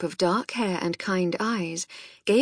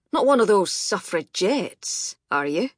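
gruff Scottish; 3 dB louder than usual. A narrator's reading. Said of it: "Mac's gruff Scottish voice".

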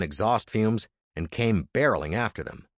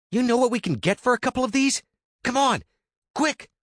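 higher, pushy younger brother; Andy's voice; 3 dB louder than usual. Acted. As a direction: urge him on, high and eager. Andy urging.

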